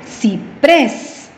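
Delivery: "Ciprés" with PRÉS emphasized; stress on last syllable. Shown as a demonstration. Teacher giving another example, "Ciprés" stressed on PRÉS. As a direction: separate CI, then punch PRÉS in "Ciprés". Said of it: "la fuerza de voz en la última sílaba".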